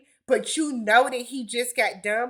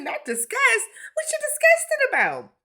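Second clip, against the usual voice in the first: high, shocked disbelief; incredulous; 13 semitones higher than usual. Real, not acted. Reacting to Marshall's confessional, high incredulous. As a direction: recoil at the word disgust.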